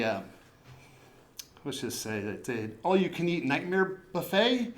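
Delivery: usual speaking voice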